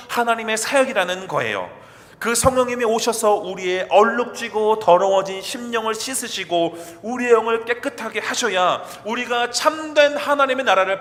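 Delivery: loud, high, fervent preaching; preaching at full force. Real, not acted. Pastor urging his congregation at the sermon's climax, fervent. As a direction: press the message home to the congregation.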